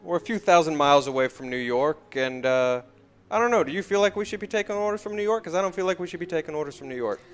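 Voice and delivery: uneasy track owner. Acted. Track owner confiding doubts to his young employee, uneasy. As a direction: talk it through fast, fishing for agreement.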